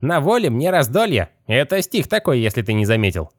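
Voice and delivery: high, otherworldly skeleton voice. Acted. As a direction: pitch it way up like a rattling skeleton.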